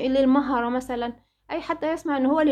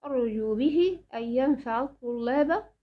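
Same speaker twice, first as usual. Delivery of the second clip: slow, measured verse line; gently chanted verse; poem recitation. Acted. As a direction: recite the closing verse slowly. Teacher recites last verse.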